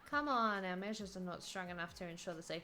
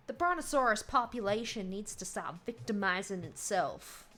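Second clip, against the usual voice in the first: put-on Texas accent; 6 dB louder than usual. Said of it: "voice this guy in, like, a Texas accent".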